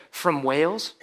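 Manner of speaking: lightly put-on British accent, rising at the end; a soft demo of a British accent. Shown as a demonstration. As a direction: try a mild British lilt. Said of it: "in your best British accent".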